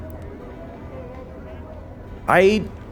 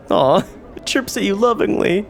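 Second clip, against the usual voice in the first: ordinary talk, nothing marked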